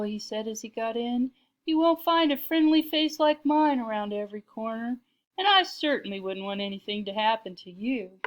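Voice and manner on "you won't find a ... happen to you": louder, higher opening that settles down; a hint of false concern; lightly voiced stranger. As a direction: play the over-friendly stranger, lightly. Voices the smooth-talking stranger.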